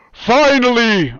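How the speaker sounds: put-on slow, high, loud cry of finally in an invented Yserian accent. Hidden voice echoing round the keep, Yserian accent. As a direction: draw out Finally like a vengeful Yserian. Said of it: "Yserian accent".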